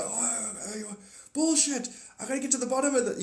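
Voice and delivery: high, loud, rushed mock outrage; acted disbelief. Acts out sceptical, outraged rational mind.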